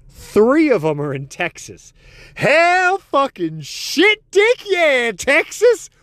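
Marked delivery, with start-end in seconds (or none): 2.3-5.9 much higher, louder, slow drawn-out yell of swear words; wild celebration. Acted. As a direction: whoop it up like a proud Texan. Celebrating Texas cussing stat.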